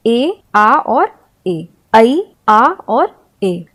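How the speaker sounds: each vowel ए, आ and ए drawn out slowly; slow vowel demo. Shown as a demonstration. Teacher to students, slowly sounding out vowels. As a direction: lay out the vowel sounds one by one. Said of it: "ए के उच्चारण बहुधा ये होते हैं".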